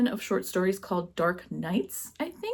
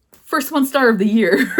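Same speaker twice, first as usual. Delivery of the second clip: loud, high laugh over the words; 5 semitones higher, 8 dB louder than usual. Real, not acted. Reviewer laughing at giving her first one-star. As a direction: laugh it out at yourself.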